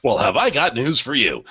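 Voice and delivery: taunting comeback with soaring question ends; exaggerated, sarcastic questioning; mock incredulous. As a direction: act out a cocky "gotcha" retort. Mocks with imagined middle-finger reply.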